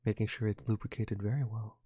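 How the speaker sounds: hushed, soft golf commentary; golf announcer. Acted. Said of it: "Just do my golf announcing the entire time".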